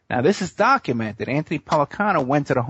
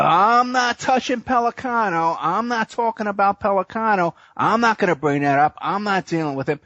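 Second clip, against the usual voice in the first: raised pitch, easing down as the refusals pile up; imitated fearful refusal; 7 semitones higher than usual. Courtroom lawyers refusing to discuss the feared investigator, imitated alarm. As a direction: mimic people backing away from a dangerous name. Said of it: "They were all afraid of Pellicano".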